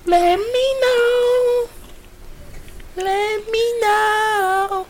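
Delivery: very high, very slow mock voice; high falsetto. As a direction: stretch it out in a squeaky falsetto. Mocking quote, joke about pilot.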